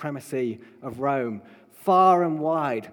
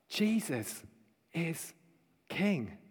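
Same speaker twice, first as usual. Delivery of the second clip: soft, word by word, with pauses; hushed declaration; 8 dB quieter, much slower than usual. Acted. Preacher telling the commoners who is King, hushed declaration. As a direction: let each word land quietly on its own. Hushed close of the acted proclamation.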